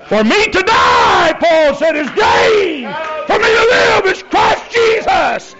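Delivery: very high, loud shouted scripture; roaring revival preacher, holding out key words; full-force preaching. Real, not acted. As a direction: shout it triumphantly to the whole church.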